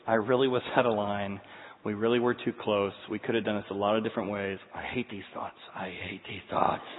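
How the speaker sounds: soft, low self-talk; quiet inner voice. Retold or imitated. Pastor thinking on the jet ski, soft inner voice. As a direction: think it to yourself softly.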